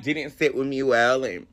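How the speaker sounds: ordinary talk, nothing marked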